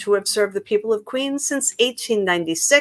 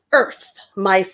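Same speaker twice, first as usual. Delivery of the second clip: storybook read; 5 semitones higher, slower than usual. A narrator's reading. Begins reading the book aloud.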